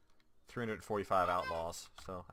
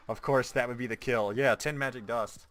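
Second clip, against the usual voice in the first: louder mock-exasperated of course; loud sarcastic exclaim; 3 semitones higher, 10 dB louder than usual. Real, not acted. Gamer grumbling at his loot drop, loud sarcasm. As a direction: bellow it, fed up at the loot.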